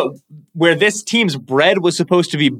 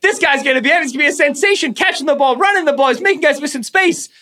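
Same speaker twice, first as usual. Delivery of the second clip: much higher, gushing with a laugh; excited fan quote; 11 semitones higher than usual. Retold or imitated. Recalling early Bijan games, giddy excited much higher gushing. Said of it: "we were like".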